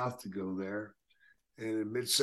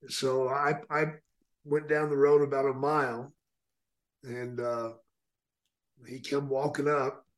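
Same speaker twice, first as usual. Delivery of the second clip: ordinary delivery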